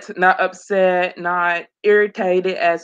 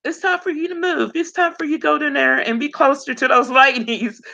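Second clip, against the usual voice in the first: lightly voiced mom, pitched higher; a hint of an urging mother, higher voice; mom's urging; 7 semitones higher, faster than usual. Mom nudging her daughter to move, lightly imitated in a higher voice. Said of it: "my mom is like".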